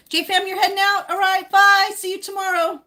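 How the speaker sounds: high, loud sing-out farewell; calling out goodbye. Real, not acted. Streamer waving off a departing viewer, high called-out goodbye. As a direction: send them off with a high, carrying call.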